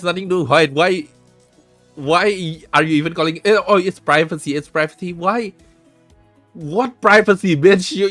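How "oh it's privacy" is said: ordinary talk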